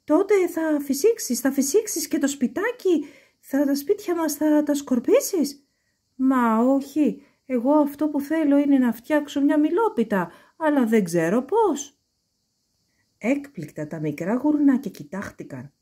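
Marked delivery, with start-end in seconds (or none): 0.0-12.0 higher piglet voice; lightly voiced piglet. A narrator's reading. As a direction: play the anxious little pig. Pigs and wolf dialogue.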